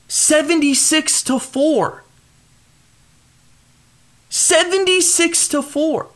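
disbelief. Real, not acted. Repeats stat in disbelief.

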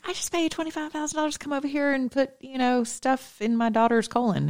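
high, hushed, fast protest; incredulous squeak of protest; indignant retort. Retold or imitated. Retelling own indignant comeback to doctor.

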